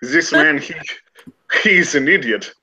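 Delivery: put-on a French scholar's accent drifting German. Remy the scholar mocking the barman, French accent. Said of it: "I keep going German".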